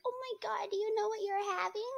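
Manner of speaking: high breathy mock gush; imitating nosy strangers. Nosy stranger gushing at a pregnant woman, high mock excitement. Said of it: "they'd be like".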